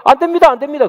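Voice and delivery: high-pitched, urgent acted protest. Peter objects to the Messiah dying, protesting. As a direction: blurt out the repeated objection as Peter. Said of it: "그래서 베드로가".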